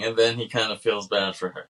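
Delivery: normal talking voice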